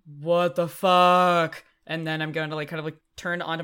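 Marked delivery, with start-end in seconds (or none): 0.1-1.6 stunned, drawn-out loud curse; shocked outburst. Acted. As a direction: let it out slow in shock. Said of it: "I think I'm just kind of like".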